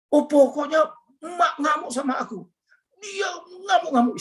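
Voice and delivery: high, wailing mock sob; imitated crying wife. Wife complains to husband about her raging mother, imitated high tearful wail. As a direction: wail it as the weeping wife, pitch climbing far up. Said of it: "Istri saya sambil nangis gitu ya".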